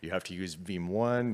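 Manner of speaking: usual speaking voice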